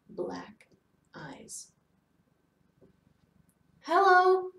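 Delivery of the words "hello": high, tense hello; loud, high and uncomfortable; uneasy greeting. A narrator's reading. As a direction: manage a strained greeting. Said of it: "she managed to say".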